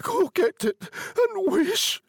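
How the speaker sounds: slow, very high insistent cry; shaking with excitement. A narrator's reading. Wife sending husband for the talisman, excited. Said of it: "shaking with excitement".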